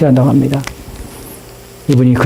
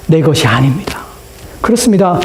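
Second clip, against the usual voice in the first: ordinary talk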